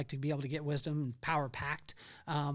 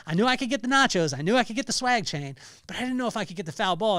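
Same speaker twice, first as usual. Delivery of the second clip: normal talking voice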